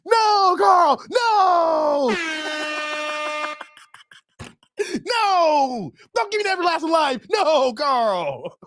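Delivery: high, drawn-out "no" cries; mock outraged refusal. Viewer refusing Carl's everlasting life, mock outraged cries. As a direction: wail it out in comic protest.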